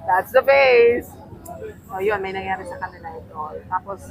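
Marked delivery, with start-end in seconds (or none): 0.0-1.1 showing the face. Shown as a demonstration. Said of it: "Alam mo yung itsura niya?".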